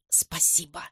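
barely voiced, very soft; hushed, lips-only murmur; mouthed thanks. A narrator's reading. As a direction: let the word slip out almost soundlessly. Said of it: "тот губами произносит".

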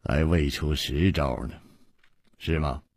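low, soft and teasing; smiling aside. A narrator's reading. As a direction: drop right down, gently needling. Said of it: "回头向黑珍珠一笑说". (含笑低声说)

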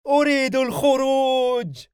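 very high, loud shouted demand; forceful scream. A narrator's reading. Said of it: "صرخ بقوة". (صراخ بقوة)

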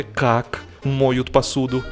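ordinary talk, nothing marked

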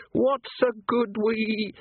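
slow, high sneering foreign voice; bogeyman sneer. Acted. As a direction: sneer it out slowly. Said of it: "it sneered".

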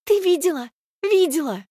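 high, loud thrilled questions; bursting with excitement; excited. Acted. Friend excited after win.

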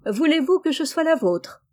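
plus haut et plus fort, élan sincère; s'écrier avec élan; eager exclamation. A narrator's reading. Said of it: "et s'écria". (Rose s'écrie, touchée, plus haut et plus fort)

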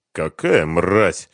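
ordinary delivery, nothing marked

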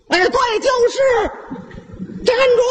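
high yell after the thief; sudden high shout of stop. Acted. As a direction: cry out to stop him. Uncle spots thief, calls out.

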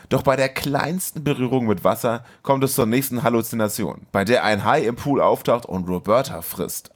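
usual speaking voice

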